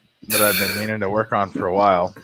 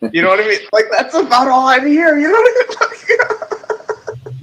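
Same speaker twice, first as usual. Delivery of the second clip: high, loud words through laughter; cracking up while asking; laughing; 7 dB louder than usual. Real, not acted. Laughing about his dad.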